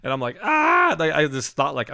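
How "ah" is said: high, loud alarmed ah; sudden high yelp of alarm; alarmed yell. Retold or imitated. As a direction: cry out falling toward a roof. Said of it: "I'm gonna hit the church".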